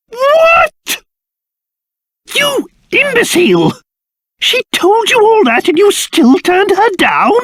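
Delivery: shrieking high-pitched disbelief; theatrical, high indignant outburst; outraged scolding. Acted. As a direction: berate a foolish underling, voice shooting up. Scolds boy for refusing her.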